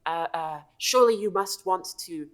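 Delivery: high, stammering devotee; eager kobold. Acted. Kobold urging a new master against the pretender, eager. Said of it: "very eager to share this knowledge with you".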